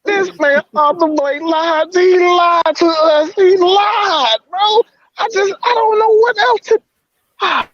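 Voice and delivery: very high, drawn-out wail of betrayal; overwrought, emotional. Real, not acted. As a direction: wail the accusation slowly, way up high. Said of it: "I'm just so emotional right now".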